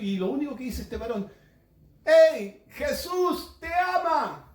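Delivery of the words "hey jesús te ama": sudden high, loud call across the street; retold shout. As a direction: yell it out to the jogger running past. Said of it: "gritó y me dijo". (grito alto y fuerte a un desconocido)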